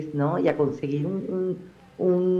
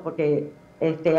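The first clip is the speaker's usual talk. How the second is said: ordinary delivery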